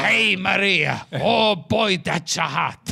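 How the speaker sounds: lightly hammed-up Chico Marx Italian accent, slow; mock Italian accent, Chico Marx flirting. Chico Marx hitting on Maria, in a mock Italian accent. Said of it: "doing the Italian accent".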